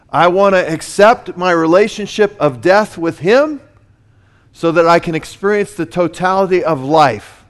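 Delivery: loud, rising pulpit delivery; thundering sermon voice; full-force preaching. Acted. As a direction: preach it out with fire.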